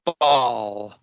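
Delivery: BASEball said again, BASE stressed; stress on the first part. Shown as a demonstration. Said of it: "You stress the first word".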